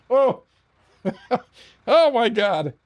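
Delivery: cracking up mid-story; real laughter. Real, not acted. Laughing at the nurse's slowness, cracking up.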